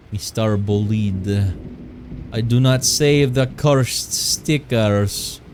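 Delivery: slow, deliberate mock-stern words; slow mock-stern scolding. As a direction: drag each word out like a playful scold. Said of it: "stern playful scolding".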